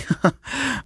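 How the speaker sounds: short high chuckle; acted light chuckling. A narrator's reading. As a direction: let out a quick amused laugh. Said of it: "Mirio chuckling, saying".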